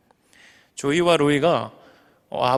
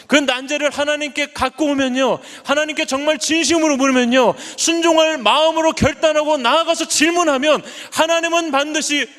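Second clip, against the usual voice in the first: impassioned closing exhortation; preaching at full force; 8 semitones higher than usual. Acted. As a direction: build the blessing to a high ringing peak. Sermon climax blessing.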